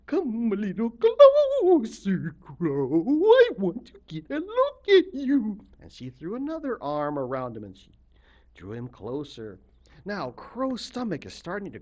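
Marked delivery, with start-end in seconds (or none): 0.1-5.6 beckoning in a high, loud, slow swoop; Octopus Lady. Acted. Octopus Lady beckoning Crow closer, slow swooping voice. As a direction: draw out the vowels to lure him in. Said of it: "and she's beckoning him".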